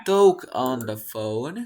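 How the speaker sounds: slow, loud, drawn-out demonstration; slow model phrase. Shown as a demonstration. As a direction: spell it out slowly and firmly for learners. Teacher models phrase for students. (modelo lento y en voz alta)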